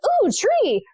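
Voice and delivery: put-on very high, bright "ooh" of a little boy; suddenly thrilled by a tree; delighted child. Son in the car spotting a tree, a high delighted coo.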